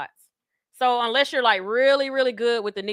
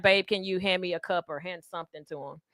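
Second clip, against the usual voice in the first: deep sensual voice; 6 semitones lower, 6 dB quieter than usual. Retold or imitated. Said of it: "very deep and just sensual".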